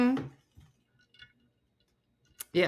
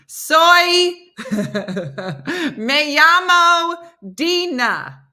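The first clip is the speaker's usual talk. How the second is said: loud, high, exaggerated Spanish accent; laughing, over-the-top Spanish introduction; ridiculous Spanish; 9 semitones higher, 8 dB louder, much slower than usual. Shown as a demonstration. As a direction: stretch each Spanish word out absurdly. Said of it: "I sound like there's something wrong with me".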